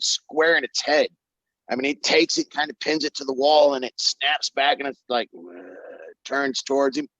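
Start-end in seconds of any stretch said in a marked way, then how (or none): none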